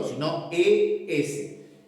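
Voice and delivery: E S drawn out slowly; slow teacher's spelling of E S; slow spelled-out demo. Shown as a demonstration. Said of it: "No S solo".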